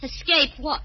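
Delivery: normal talking voice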